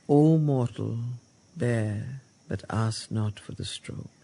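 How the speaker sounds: slow, solemn verse reading with weight on key words; poem recitation. Acted. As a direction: read it like a sacred text. Rereading the Savitri passage.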